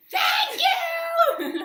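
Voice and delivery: yelled thank you, very high; aggressive shout. Retold or imitated. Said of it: "so aggressive".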